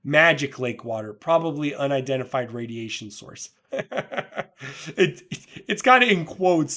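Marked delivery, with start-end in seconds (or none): 3.7-4.9 high breathy burst of laughter; a real laugh at the joke. Real, not acted. Reader cracking up at the caption, laughing. 4.9-6.8 talking through a real laugh, higher and louder; words bubbling with laughter. Real, not acted. Reader amused by the quoted caption, laughing through the words.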